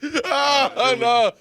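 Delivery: high, loud wail through laughter; laughing dismay. Real, not acted. Co-host after the jokes went too far, real laughing wail. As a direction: cry out while cracking up.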